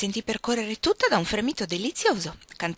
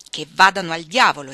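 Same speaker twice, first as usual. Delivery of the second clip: loud, forceful exclamation; exclaiming; 9 dB louder than usual. A narrator's reading. Orlando curses her new duties as a woman, exclaiming loudly. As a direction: burst out with the curse, much louder. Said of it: "esclamò".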